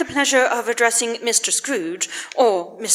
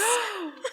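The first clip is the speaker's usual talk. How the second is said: shocked gasp; 7 semitones higher, 12 dB quieter than usual. Acted. Scrooge refuses to give.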